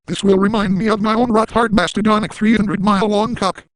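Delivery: ordinary talk, nothing marked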